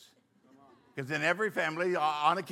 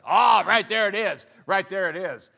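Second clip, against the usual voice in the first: loud aha, jabbing at the words; triumphant find; 3 semitones higher, 10 dB louder than usual. Retold or imitated. Pastor mocking his young self pointing at adultery in the list. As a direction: pounce on it like a prosecutor.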